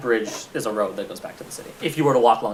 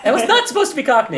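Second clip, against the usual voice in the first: high, laughing protest; amused protest; 12 semitones higher, 4 dB louder than usual. Real, not acted. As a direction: object through laughter. Mock protest at teasing about accent.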